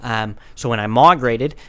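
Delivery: Australian wildlife presenter spoof; Jack Wallaby, Australian accent. Acted. Presenting his horse dog to the host, Australian accent. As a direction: play an Australian animal showman. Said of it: "Like Jack Wallaby".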